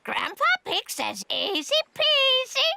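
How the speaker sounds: high parrot echoing a phrase; high talking-bird voice; parrot mimicry. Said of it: "I can teach Polly what to say".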